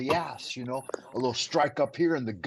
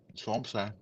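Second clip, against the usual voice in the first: ordinary talk, nothing marked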